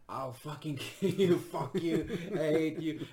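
loud, high, hammed-up drunken fury; drunken rage. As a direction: scream threats like a wasted heckler. Second girl threatens him.

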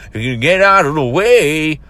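loud, high, drawn-out mocking demand; mock rude yell. Mimicking the rude man demanding room in the aisle, mock yell. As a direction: imitate an obnoxious shopper's bellow.

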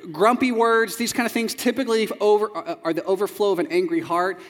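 ordinary talk

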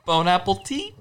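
Bone Apple Tea, rising on tea; play-read Bone Apple Tea like a menu misread; mispronounced Bone Apple Tea. Shown as a demonstration. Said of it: "in case you missed it".

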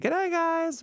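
high sing-song goodnight; mock goodnight. Wife calling it a night, sung out high. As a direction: chirp the goodbye way up high.

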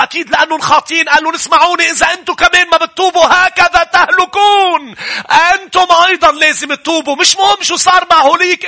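high, loud, impassioned sermon; full-force preaching; fiery preaching. Acted. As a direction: drive repentance home at full force.

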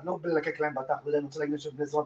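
normal talking voice

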